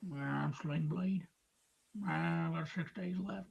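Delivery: light Sling Blade impression, low and soft. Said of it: "You ever see Sling Blade".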